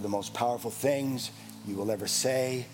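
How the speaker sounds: low, soft, slowing invitation; hushed appeal. Acted. Pastor inviting the congregation to stand, in a hush. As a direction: draw them in close and quiet. Intimate altar call.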